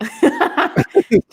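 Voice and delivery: a real laugh through tears; teary laugh. Real, not acted. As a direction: laugh it off while tearing up. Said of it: "Before [name] tears up".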